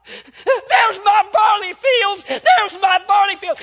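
full-force high scream of a horrified father; high, drawn-out anguished yell; anguished shout. Retold or imitated. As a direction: cry out in desperate alarm, very loud. Father sees his son struck.

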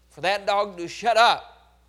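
ordinary talk

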